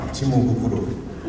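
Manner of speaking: normal talking voice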